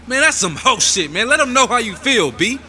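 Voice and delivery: high, worked-up hothead voice; outraged goading. Acted. Inner voice pushes him to speak up, high goading shout.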